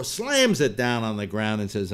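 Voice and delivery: ordinary delivery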